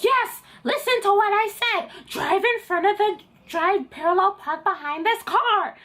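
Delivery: high, hammed-up scolding; exasperated examiner. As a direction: snap orders in a shrieky put-on voice. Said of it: "Why are you screaming?".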